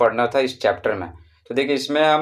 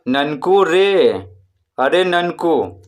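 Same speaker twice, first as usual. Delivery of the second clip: slow, higher call with long-held vowels; 3 semitones higher, much slower than usual. Acted. Teacher acts someone calling Nanku, drawn-out call. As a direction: call out to someone, stretching रे and Nanku. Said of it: "जैसे किसी को पुकारते हैं ना".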